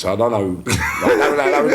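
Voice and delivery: ordinary talk, nothing marked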